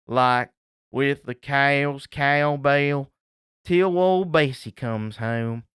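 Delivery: slow Appalachian hillbilly drawl; hillbilly accent, dragged out slowly. Retold or imitated. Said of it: "I'm gonna say it like a hillbilly".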